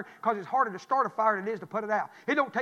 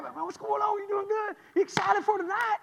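eager greeting; 10 semitones higher than usual. Retold or imitated.

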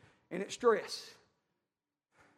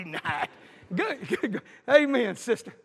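normal talking voice

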